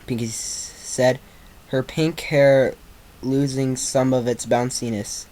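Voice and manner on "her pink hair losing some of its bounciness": plain reading voice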